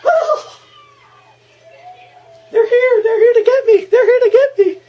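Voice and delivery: put-on very high, loud, laughing old woman cry; hammed-up old lady panic. Voicing Missus Deagle in fright, very high mock wail. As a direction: clutch your heart and wail it.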